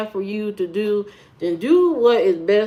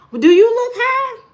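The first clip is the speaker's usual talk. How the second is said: very high, loud challenge; squeakily high, affronted question; indignant retort; 6 dB louder than usual. Real, not acted. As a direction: fire the accusation right back. Retorts to accusation of looking high.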